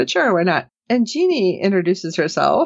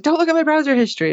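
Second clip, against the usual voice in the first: quick mock warning; 9 semitones higher, much faster than usual. [name] grabs Rachel's phone.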